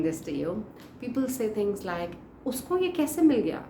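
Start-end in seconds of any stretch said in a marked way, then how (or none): 2.4-3.6 high-pitched incredulous gossip; mock disbelief. People gossiping about someone else's match, mock incredulous.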